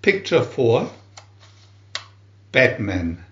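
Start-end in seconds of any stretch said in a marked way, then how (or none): none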